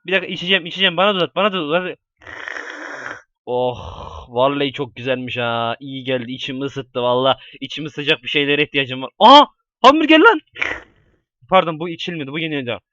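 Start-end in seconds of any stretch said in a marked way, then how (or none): 3.5-9.1 lower, softer satisfied voice; contented sigh. Pretend. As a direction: relax after a warm drink. Drinking something warm.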